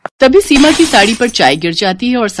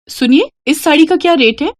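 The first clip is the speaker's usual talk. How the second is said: put-on much higher polite girl voice; girl asking; 9 semitones higher than usual. Seema asking shopkeeper the price, high and sweet.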